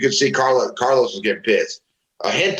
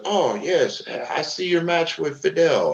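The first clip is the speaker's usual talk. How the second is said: higher, slow put-on voice of the old star; retelling the veteran's first words to him, in a higher, slow put-on voice; imitating the veteran; 7 semitones higher, slower than usual. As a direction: mimic the veteran sizing up the new guy, slow and higher. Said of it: "And he goes".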